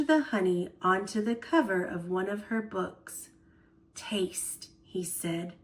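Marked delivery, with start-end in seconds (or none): 4.0-4.7 soft breathless whisper. A narrator's reading. Grandpa offers Mary Ellen honey, whispered. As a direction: barely breathe the word. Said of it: "almost in a whisper".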